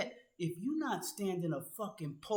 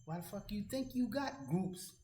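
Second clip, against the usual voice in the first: usual speaking voice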